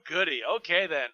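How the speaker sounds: ordinary talk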